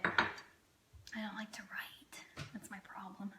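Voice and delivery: soft breathy whisper, half to herself; whispered aside. Real, not acted. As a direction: drop to a confiding whisper. Joking aside about writing.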